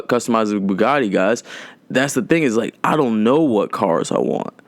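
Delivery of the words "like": ordinary talk, nothing marked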